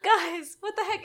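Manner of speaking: high, laughing what the heck; laughing exasperation. Retold or imitated.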